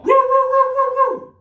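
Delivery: very high, loud yappy woofs; rapid, excited imitation dog barks; doggy barking. Shown as a demonstration. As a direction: yap like a little dog for kids. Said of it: "What kind of sound does a doggy make?".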